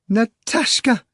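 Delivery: high joyful scream across the sky. Acted. Margarita screaming to Natasha in flight, high. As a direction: call her name at full pitch. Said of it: "Margarita screamed".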